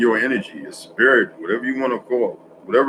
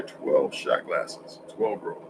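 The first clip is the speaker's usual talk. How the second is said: mock whisper; 7 semitones lower, 10 dB quieter, much slower than usual. Retold Mason's instruction.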